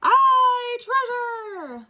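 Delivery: very high, loud crew shout; pirate shout. Acted. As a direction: whoop for the treasure. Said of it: "the others shouted".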